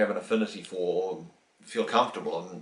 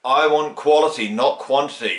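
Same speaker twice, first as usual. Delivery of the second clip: much louder, commanding guru; absolute authority; 5 semitones higher, 10 dB louder than usual. Retold or imitated. Guru's third absolute command to the devotees, loud. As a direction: let the force hit the listener. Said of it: "that authority was like so absolute".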